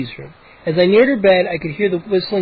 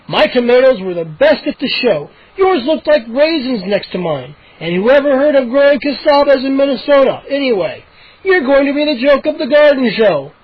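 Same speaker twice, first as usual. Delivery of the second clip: louder, higher mocking retort; angry boast; 6 semitones higher, 3 dB louder than usual. A narrator's reading. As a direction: throw the insult back. Dad quarrels with neighbor.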